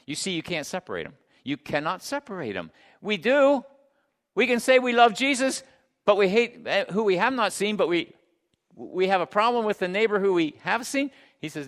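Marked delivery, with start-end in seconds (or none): 3.0-11.1 high, loud pulpit challenge; forceful preaching. Real, not acted. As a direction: drive the point at the congregation.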